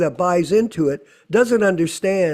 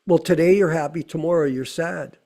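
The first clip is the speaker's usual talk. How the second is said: normal talking voice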